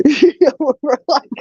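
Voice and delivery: usual speaking voice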